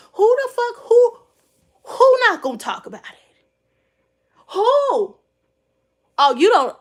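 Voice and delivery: loud, high disbelieving outburst; sudden loud outrage at a commenter; indignant shout. Real, not acted. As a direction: yell who the fuck in disbelief.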